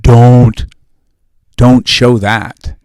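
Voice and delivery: slow, loud, understated stern order; mock stern warning. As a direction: lay down the family rule slowly. Family rule voiced.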